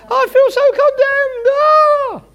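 high, whiny cry of a condemned believer; mock despair. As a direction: ham up the wail of feeling condemned.

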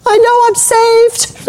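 high, slow, elated cry of joy; excited exclamation. Retold or imitated. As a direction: mimic a thrilled young woman announcing good news. Tina's joyful announcement at door.

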